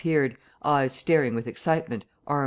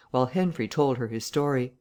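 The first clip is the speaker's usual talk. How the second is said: ordinary delivery, nothing marked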